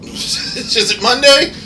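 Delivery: high, slow, disbelieving question; baffled. Real, not acted. Asking viewers the weekday, slow and bewildered. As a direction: drag it out in mock confusion. Unsure what day it is.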